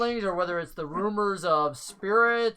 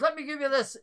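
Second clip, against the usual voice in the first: NPC voice; 7 semitones higher, faster than usual. Acted. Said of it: "he goes".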